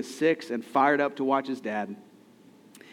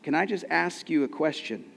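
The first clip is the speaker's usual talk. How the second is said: ordinary talk, nothing marked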